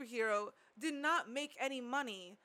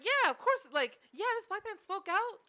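louder, higher sarcastic mockery; 8 semitones higher, 5 dB louder than usual. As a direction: sneer at the naive ending, loud and high. Mocking Disney's naive ending.

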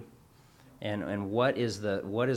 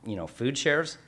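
usual speaking voice

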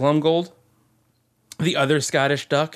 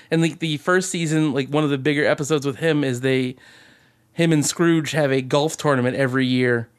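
ordinary delivery

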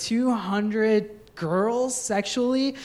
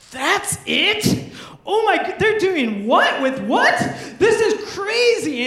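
high, loud, laughing disbelief, slowing at the end; shocked kids; 9 semitones higher, 5 dB louder than usual. Retold or imitated. Child friends seeing the booklet, shouting in giggly shock.

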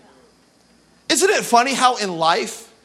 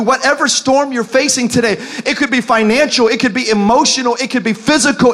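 usual speaking voice

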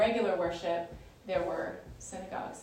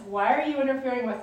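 loud, raised, hurried challenge; crying out; 5 semitones higher, 10 dB louder, faster than usual. Retold or imitated. Reading the possessed man's outburst to the group, crying out. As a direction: cry out higher and louder. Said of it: "evil spirit who cries out".

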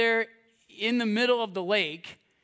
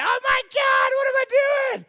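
middle-of-the-night panic; 7 dB louder than usual. Acted. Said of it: "Wake up in the middle of the night".

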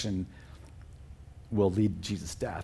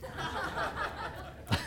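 high airy burst of laughter; amused laugh; 8 dB quieter than usual. Real, not acted. Laughs at stinketh.

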